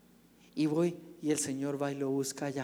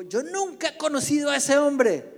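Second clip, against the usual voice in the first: much higher, loud shouted denial; desperate denial; 11 semitones higher, 8 dB louder than usual. Acted. Peter denies knowing Jesus, high loud shout. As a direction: shout it to shake off the accusation.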